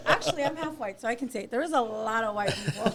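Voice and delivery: ordinary talk, nothing marked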